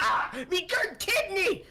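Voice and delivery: high, slow cry of pain in a rough voice; pained yelp in character. Acted. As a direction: squeal as the claw hits the kidney. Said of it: "He's like".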